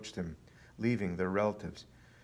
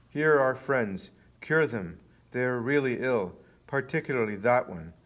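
louder, emphatic presenting; insistent challenge; 3 semitones higher, 6 dB louder than usual. A narrator's reading. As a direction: press the demand on him, loudly. Enemies present their sick.

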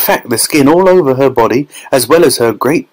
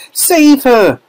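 very high pleading cry; mother begging for rescue; desperate cry. A narrator's reading. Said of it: "cried Missus Beauregard".

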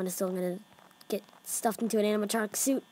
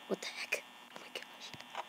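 hushed, breathy fright; scared whisper; 17 dB quieter than usual. Real, not acted. Gamer whispering in fear at the screen. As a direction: whisper so the monsters won't hear.

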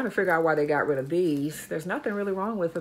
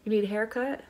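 pet voice; 4 semitones higher, 4 dB quieter than usual. Real, not acted. Talking to the dog again.

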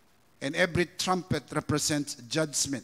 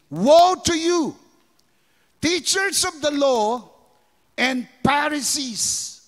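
slow, loud, high preaching of woe; preaching at full force; 5 semitones higher, 4 dB louder, slower than usual. Acted. Preacher voicing Jesus rebuking the Pharisees, forceful. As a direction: thunder it at the hypocrites.

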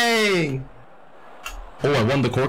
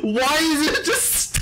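laughing hard, much higher, through the words; can't stop cracking up at the stare-off; helpless laughter; 12 semitones higher than usual. Real, not acted. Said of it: "Why is this so funny?".

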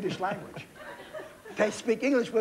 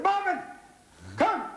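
high urgent call, much higher; excited call; 12 semitones higher than usual. Retold or imitated. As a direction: call him over in a rush, higher. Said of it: "I said".

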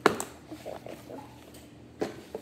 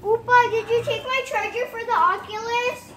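yelling across the room; 7 semitones higher, 5 dB louder than usual. Real, not acted. Calling to grandpa across room.

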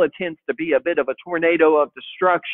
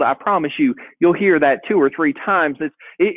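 normal talking voice

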